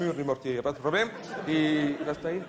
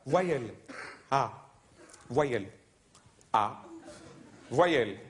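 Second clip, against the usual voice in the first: low, deadpan game show calls; game show contestant; 6 semitones lower, slower than usual. Acted. Comic mimics a Russian game show in a low deadpan voice. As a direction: drone each letter like a bored contestant.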